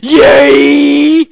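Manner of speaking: shouted high yay; loud cheer. Real, not acted. Gamer yelling after clearing the hard stage, shouted yay. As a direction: let out a big victory yell.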